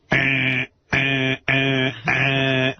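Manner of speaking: droning "eh" buzzes on one note; imitated doorbell buzzer. Storyteller imitating his front doorbell, monotone buzzer. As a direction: mimic a buzzing doorbell with the voice. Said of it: "It's my front doorbell".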